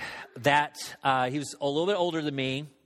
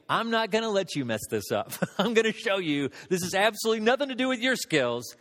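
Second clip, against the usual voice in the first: voice of God; 7 semitones lower than usual. Retold or imitated. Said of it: "it was like God was saying".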